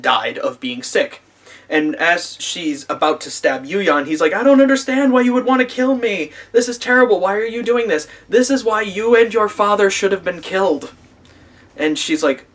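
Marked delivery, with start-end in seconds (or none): 4.3-10.9 high-pitched imitation of panicked protest; mock pleading. Man about to be stabbed protesting to attacker, mock pleading.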